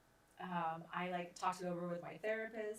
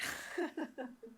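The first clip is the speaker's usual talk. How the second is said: a bright laugh, pitched up; high laugh; 6 semitones higher than usual. Real, not acted. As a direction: laugh at how fast she ran. Laughing after booking it.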